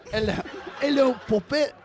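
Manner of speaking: high, loud British pirate hello; hammed-up British accent, rising and laughing; Pirates of the Caribbean British. Shown as a demonstration. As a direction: put on a big British pirate-film voice. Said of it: "That's my Pirates of the Caribbean one".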